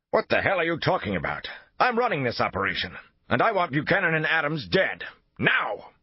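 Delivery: high furious yell; angry shout. A narrator's reading. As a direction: bellow it in rage. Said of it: "face flushed with anger".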